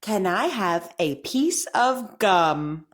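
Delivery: slow, careful speech-therapy reading; crisp model sentences for repeating; over enunciating. Shown as a demonstration. Therapist reads practice sentences.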